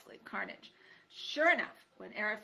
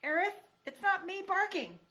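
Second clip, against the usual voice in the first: high, pleading mouse voice; small timid mouse begging; mouse girl pleading; 11 semitones higher, 6 dB louder than usual. Acted. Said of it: "mouse pleads with porcupine".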